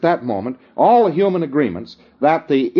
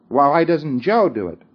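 ordinary talk, nothing marked

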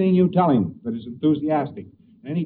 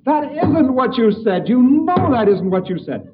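mock outburst, much higher, louder, slow; an angry man accusing the fearful one of lying; angry accusation; 10 semitones higher, 6 dB louder, slower than usual. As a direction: hammer each word at him. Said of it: "And the fellow said".

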